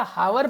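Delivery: हावर with the H sounded; wrongly pronounced हावर with an audible H; mistaken pronunciation. Shown as a demonstration. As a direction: say हावर, voicing the initial H. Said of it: "इसे आर बोलते हैं".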